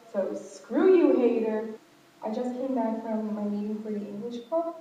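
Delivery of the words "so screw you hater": loud, slow defiant taunt; defiant jab. Real, not acted. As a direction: throw it at the hater.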